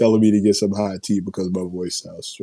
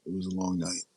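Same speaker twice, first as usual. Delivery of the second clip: quiet take; 11 dB quieter, faster than usual. Real, not acted. Host repeating to chat how long the night was, softly. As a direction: let it fall away softly, tired. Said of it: "my voice is".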